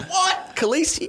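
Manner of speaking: very high, startled questioning; hammed-up high-pitched "huh?" reaction; mock surprise. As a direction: act caught out, pitch shooting right up. Acted distracted blokes in class.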